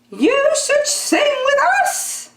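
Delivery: very high, piping chorus voice; a flock of tiny birds inviting him; singsong birds. Acted. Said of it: "said the birds".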